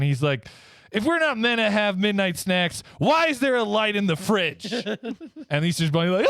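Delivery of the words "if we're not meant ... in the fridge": mock tough Santa. Santa pitching to Easter Bunny.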